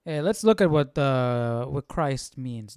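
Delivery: ordinary talk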